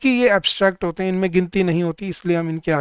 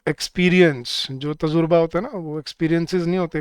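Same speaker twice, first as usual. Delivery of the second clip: ordinary talk, nothing marked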